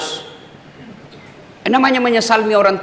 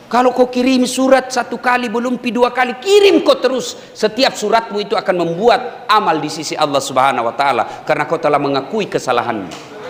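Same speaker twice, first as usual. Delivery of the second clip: ordinary talk, nothing marked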